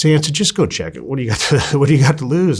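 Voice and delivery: imitated coach's nudge; coach urging. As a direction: push him to go look, half laughing. Coach urging him to check.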